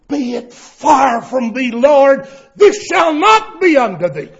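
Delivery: loud, high rebuke of Jesus; Peter rebuking. Retold or imitated. Peter rebuking Jesus, loud high protest. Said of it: "began to rebuke him".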